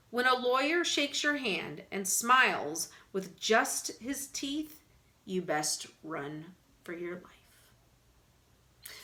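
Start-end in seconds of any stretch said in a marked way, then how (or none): 4.0-8.9 soft, slow ominous warning; slow down and darken it; ominous hush. A narrator's reading. Narrator warning about smiling lawyers, hushed.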